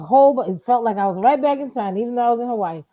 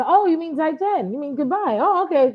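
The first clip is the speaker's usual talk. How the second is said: much higher, amused realization; surprised Chinese listener; 7 semitones higher than usual. Retold or imitated. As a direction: play the local who suddenly understands.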